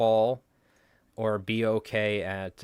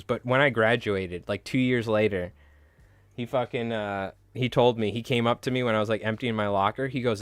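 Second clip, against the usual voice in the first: usual speaking voice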